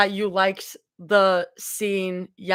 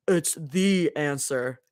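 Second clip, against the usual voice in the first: Abby voice; 7 semitones lower, 4 dB quieter, slower than usual. Acted. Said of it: "my Abby voice".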